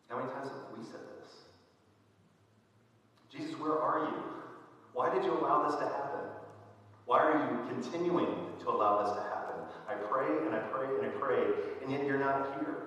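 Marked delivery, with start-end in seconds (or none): 3.3-4.2 higher and louder, crying out to God; anguished cry. Retold or imitated. Believers' plea in tragedy. 4.9-12.8 pleading complaint. Retold or imitated. Believers' plea in tragedy.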